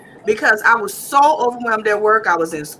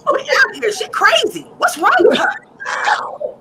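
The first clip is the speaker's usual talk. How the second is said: put-on high, whiny teen voice; mocking teenagers; 12 semitones higher, slower than usual. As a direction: play the scared kids whining about mom. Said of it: "so they're like".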